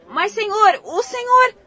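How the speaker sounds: high, loud startled plea; shocked protest. Acted. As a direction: cry out in alarm. Reporter pleads as farmer shouts. (protesto espantado)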